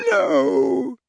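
high, agonized groan; drawn-out pained wail. A narrator's reading. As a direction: groan the refusal out in pain. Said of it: "he groaned as Harry lowered the goblet".

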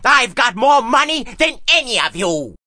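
high-pitched furious yell; acted screaming, high and defiant; screamed boast. A narrator's reading. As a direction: yell it as a tantrum. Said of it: "Drake screamed".

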